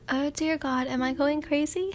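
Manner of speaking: plain reading voice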